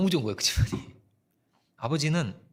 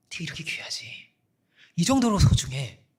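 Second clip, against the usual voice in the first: tender assurance; 3 dB louder than usual. Acted. Voicing God's tender assurance.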